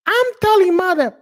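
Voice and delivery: very high whiny British brat; spoiled child tattling to mother; mock British accent. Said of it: "and be like".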